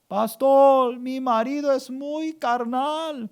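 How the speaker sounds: high, plaintive mock complaint; complaining wife. Wife complains to pastor about her husband, mock plaintive. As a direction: wail it like an upset parishioner.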